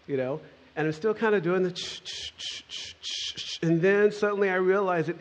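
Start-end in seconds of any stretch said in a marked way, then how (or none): 1.7-3.6 quiet voiceless shaker noise; imitated maraca shaking. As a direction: mimic the maraca with soft, rhythmic puffs.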